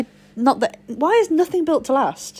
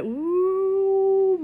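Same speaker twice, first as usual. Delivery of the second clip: falling "ooh"; 9 semitones higher than usual. Retold or imitated. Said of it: "It was like".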